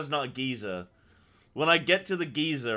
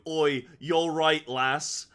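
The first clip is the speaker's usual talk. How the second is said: slow West Country "Oi, you're right, lass", a bit louder; West Country accent; 3 semitones higher, 5 dB louder, much slower than usual. Shown as a demonstration. Streamer showing the accent he can't resist, West Country accent. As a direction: drawl it like a West Country sailor. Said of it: "you can't not do".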